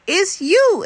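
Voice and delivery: exclaimed. Acted. Hedgehog telling the skunk he is his best friend, excited exclamation. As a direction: burst out with the happy news. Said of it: "Elmore exclaimed".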